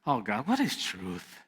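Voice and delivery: tired eye-roll of a question; weary dismissive Pilate. Shown as a demonstration. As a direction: brush the question off like it bores you. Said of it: "He says it like this. Let me show you".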